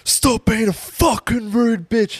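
imitating an enraged lifter yelling at a woman; mock scream. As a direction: throw the insult like a furious gym guy. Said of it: "he screamed in her face".

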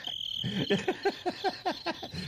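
long, high wheezing laugh; helpless laughter. Real, not acted. Host cracking up at his empty-house joke, laughing.

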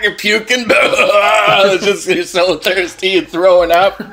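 ordinary delivery

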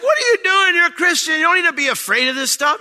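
high, loud exasperated cry; retold yell. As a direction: shout it at the cashier, high and loud. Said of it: "And I'd be like".

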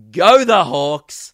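loud, drawn-out team chant; gleeful yell. Retold or imitated. Winding up rival fans on the train, loud yell. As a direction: yell it out long like a footy fan. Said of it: "I just yelled".

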